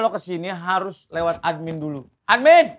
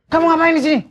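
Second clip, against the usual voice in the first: high, loud challenge to an intruder; scolding shout; 14 semitones higher, 5 dB louder than usual. Acted. Guard confronts the trespassing vlogger, scolding loudly. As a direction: demand it sharply at a raised pitch.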